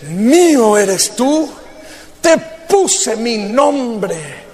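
God's words spoken slowly, with delight; slow and fond, as God speaking; quoting God slowly and fondly; slow, gentle voice of God. Acted. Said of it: "el Señor gustosamente dice".

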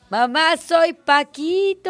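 put-on high, very drawn-out child's recital; sing-song it like a naughty little boy; slow, pleading kiddie voice; little Paquito. Quotes child character's rhyme.

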